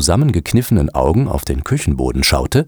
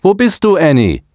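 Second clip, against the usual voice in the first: high, raised searching call; booming call; 11 semitones higher, 3 dB louder than usual. A narrator's reading. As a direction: call out to find her. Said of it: "dröhnte eine Männerstimme durchs Haus". (laut und hoch suchend gerufen)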